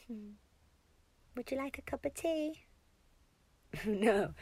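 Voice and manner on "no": usual speaking voice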